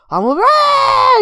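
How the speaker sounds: words bursting into a high, loud scream; mock screaming. Showing how the character ends every sentence, high mock scream. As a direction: start the sentence, then shriek over the rest. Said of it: "He just screams".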